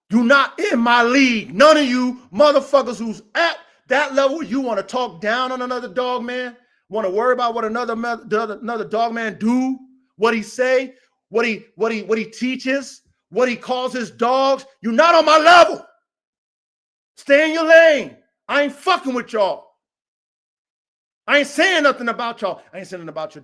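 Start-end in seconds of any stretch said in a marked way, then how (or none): 0.1-4.4 loud, furious call-out; angry shout. Real, not acted. Breeder shouting at critics in his comments, angry. 14.8-21.8 loud, high furious yell; angry shout. Real, not acted. Breeder shouting down commenters, furious and loud. Ranting at online critics.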